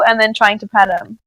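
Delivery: ordinary talk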